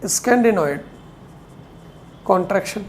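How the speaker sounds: word by word, with long pauses; measured note-taking dictation; slow dictation. Real, not acted. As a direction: dictate slowly, leaving time to write. Dictating notes for students to write.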